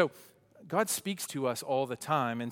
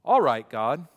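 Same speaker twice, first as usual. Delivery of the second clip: loud British-style greeting; British accent; 11 dB louder than usual. Acted. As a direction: hail them casually in a British accent. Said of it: "that's my best British accent".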